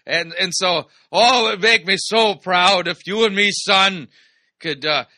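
loud, hammed-up pirate dad voice; pirate dad accent. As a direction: play a proud old-country father with a mock pirate accent. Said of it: "what kind of accent that was".

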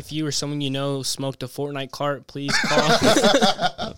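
hammy TV lawsuit pitch; injury lawyer ad. Pretend. Mock injury lawyer commercial.

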